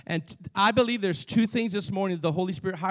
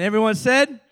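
louder call for amen; 2 semitones higher, 6 dB louder than usual. Acted. Repeats call louder for response.

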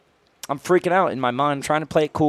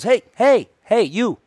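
retold call-out, pitched up; 7 semitones higher, slower than usual. Security guard hailing the storyteller as he walks off, calling out. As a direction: imitate someone hailing a man from behind.

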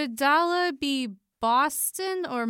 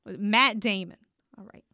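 ordinary delivery

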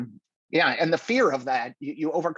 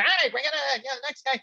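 much higher, brash host voice calling the next act; Jay hyping the crowd; 11 semitones higher than usual. Retold or imitated. Host calling the next comic to the stage, brash and high. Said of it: "and then Jay's going".

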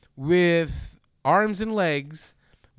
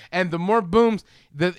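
usual speaking voice

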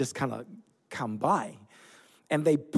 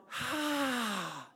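long, high falling "ahh"; acted-out exasperated exhale; exasperated sigh; 7 semitones higher, 7 dB quieter than usual. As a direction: let out a long, soft sigh, pitched high. Acting out exasperation at the youths.

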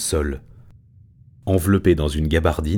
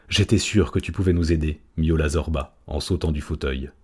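plain reading voice

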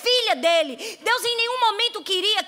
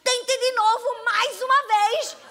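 put-on slow, high coaxing voice of a child; big sister drawing each word out, urging; 7 semitones higher, 4 dB louder, much slower than usual. Older sister urges Laura to try again, slow and coaxing.

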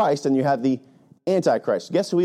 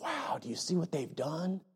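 mock awed hush; 4 semitones higher, 12 dB quieter than usual. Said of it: "and just be like".

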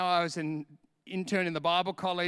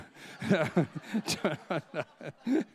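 continued real laughter; 2 semitones lower than usual. Real, not acted. Preacher still laughing at the joke, real laughter. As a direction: keep laughing.

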